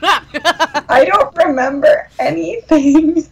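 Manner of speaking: slow words through laughter; laughing. Real, not acted. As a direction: laugh while saying it. Laughing about math.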